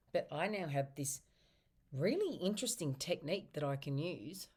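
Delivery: normal talking voice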